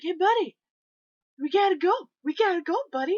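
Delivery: high, loud mock taunt; teasing heckle; taunting fan. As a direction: rap on the glass and jeer. Fan taunting goalie at glass.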